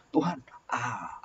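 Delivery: slow whispered appeal to God; hushed plea. Retold or imitated. Abraham whispering a slow plea to God. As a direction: breathe the words as a pleading whisper.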